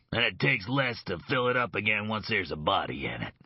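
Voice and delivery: a thug savouring his threat; gloating threat. Acted. Leger threatens with graves.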